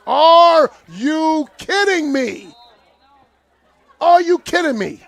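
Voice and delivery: real incredulous protest; outraged disbelief. Real, not acted. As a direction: stretch it out, stunned and outraged. Outraged at timeout call.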